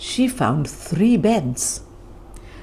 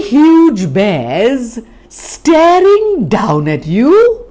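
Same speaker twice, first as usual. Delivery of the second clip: louder and rising, slow start; dramatic build; 6 semitones higher, 7 dB louder, slower than usual. A narrator's reading. Narrator scaring the children, louder and climbing. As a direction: build the fright to a peak.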